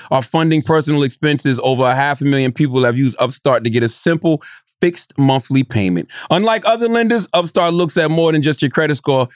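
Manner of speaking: upbeat sponsor ad read. Acted. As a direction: read it like a radio spot. Reads a sponsor ad.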